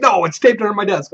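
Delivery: high-pitched "No" of protest; acted insistence. Player answering a doubter, high acted insistence.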